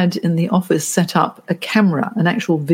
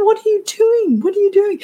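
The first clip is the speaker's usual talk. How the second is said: incredulous retold question. Coach confronting client on camera.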